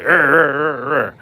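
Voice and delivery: imitating a growling fighter, ready to brawl; mock fighting-dog growl; hyped-up growl. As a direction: growl it out like you're itching to fight. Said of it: "always being, like, hyped, ready to fight".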